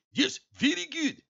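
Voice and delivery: high-pitched, hammed-up Indian accent; horrible Indian accent impression. Shown as a demonstration. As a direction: put on a bad, sing-song Indian accent. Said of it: "horrible, uh, impersonation".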